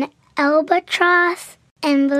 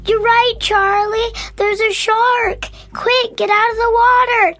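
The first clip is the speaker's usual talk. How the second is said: higher, urgent raised voice; surprised alarm; 6 semitones higher, 3 dB louder than usual. Acted. Dad finally sees the shark and warns his son, alarmed. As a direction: sound the alarm, pitched up. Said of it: "with surprise".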